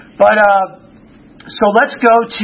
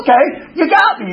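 higher, quick outburst of giving up; exasperated exclamation; 7 semitones higher, faster than usual. Retold or imitated. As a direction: throw up your hands and blurt it out. Surrendering to God, exclaiming.

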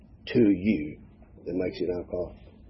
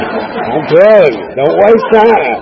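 slow, louder, insistent warning; urgent urging; 4 semitones higher, 4 dB louder, slower than usual. Retold or imitated. Speaker pressing a ninety-two-year-old newcomer to hurry, urgent. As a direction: lean hard on each word, drawing it out.

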